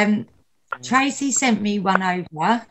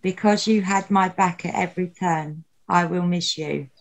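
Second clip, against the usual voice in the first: nervous open mic poet; 3 semitones lower than usual. Acted. Reads a memorial poem aloud.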